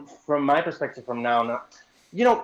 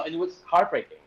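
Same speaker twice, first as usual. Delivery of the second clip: normal talking voice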